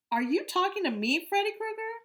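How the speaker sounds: high, loud mocking sneer; a bully jeering at a small boy; taunting bully. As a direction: sneer down at the little kid.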